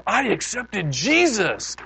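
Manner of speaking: high, loud, drawn-out quoted proclamation; big retold declaration of faith; joyous announcement. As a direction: imitate an elated convert, slow and high. Quoting uncle's joyful announcement.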